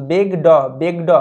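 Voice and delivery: বেগ ড with a separate open ড syllable; wrong full "daw" ending. Shown as a demonstration. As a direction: mispronounce it as two words, "beg daw", in বেগ ড. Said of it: "এরকমভাবে আবার উচ্চারণ করে দিও না". (বেগ ড, শেষে পুরো ড দিয়ে ভুল উচ্চারণ)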